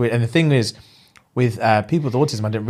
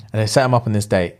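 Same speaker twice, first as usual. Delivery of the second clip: normal talking voice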